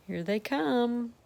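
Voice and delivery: plain reading voice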